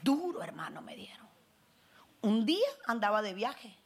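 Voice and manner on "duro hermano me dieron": hushed, wounded whisper; whispered complaint. Retold or imitated. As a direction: whisper how hard they hit. Whispered complaint about harsh criticism.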